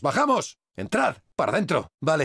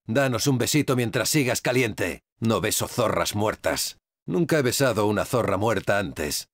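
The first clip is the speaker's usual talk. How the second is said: sardonic; 8 semitones lower than usual. Acted. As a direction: say it low and dry over the corpse. Sardonic talk over dead enemy.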